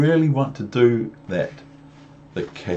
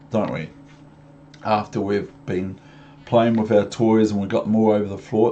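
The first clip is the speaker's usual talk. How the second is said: plain reading voice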